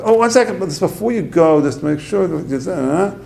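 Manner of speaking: high-pitched imitation of a wife interrupting; imitated hurried wife. Wife calls as husband leaves.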